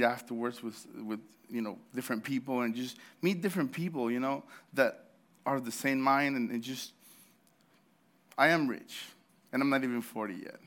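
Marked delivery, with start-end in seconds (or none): none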